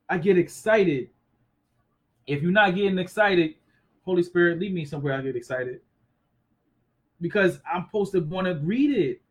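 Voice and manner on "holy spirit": usual speaking voice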